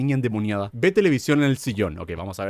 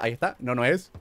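normal talking voice

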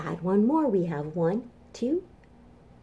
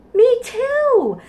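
excited; 9 dB louder than usual. Acted. Excited answer to dinosaur question.

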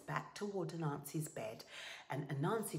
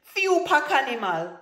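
high, very loud outburst; indignant exclamation; 9 semitones higher, 17 dB louder than usual. Acted. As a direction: exclaim it loudly in outrage. Indignant exclamation in acted story.

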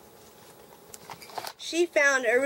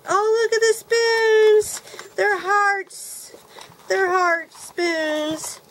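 much higher, loud and slow, drawn-out delight; delighted squeal; 8 semitones higher, 4 dB louder, much slower than usual. Real, not acted. Vlogger discovers heart-shaped mixing spoons, delighted drawn-out squeal. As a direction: stretch it out like you can't believe it.

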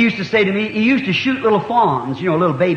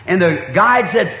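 normal talking voice

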